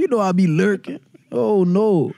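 playful put-on New Orleans twang; New Orleans accent. Telling them he lurks around, New Orleans accent. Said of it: "the New Orleans deals".

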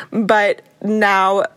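ordinary talk, nothing marked

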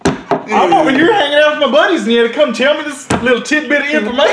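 mock indignant. Retold outrage at girl.

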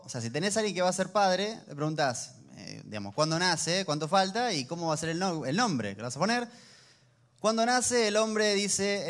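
usual speaking voice

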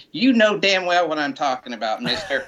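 small Southern mother scolding a rock star, pitched high; imitating mom scolding. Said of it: "and he goes".

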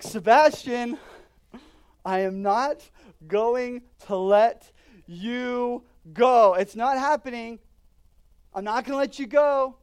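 loud, slow, firm vow; emphatic promise. Retold or imitated. Father vowing to his frightened son amid big waves, a loud slow vow. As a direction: hammer each word home.

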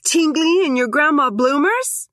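high, mocking doppelganger taunt. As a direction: goad her like a feral twin.

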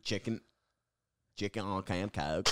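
quiet try at Scouse that comes out Southern; failed Scouse accent; attempted Scouse. Shown as a demonstration. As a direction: copy the Scouse accent, landing American Southern. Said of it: "Sounds like Southern".

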